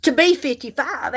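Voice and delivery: normal talking voice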